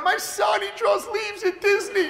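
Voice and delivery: high, loud boasting mom; proud mother. Retold or imitated. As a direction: crow about your son to everyone. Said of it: "She's so proud".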